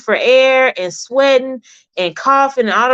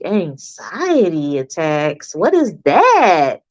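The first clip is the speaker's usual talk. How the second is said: puzzled; 4 semitones lower, slower than usual. Retold or imitated. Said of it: "And I was like".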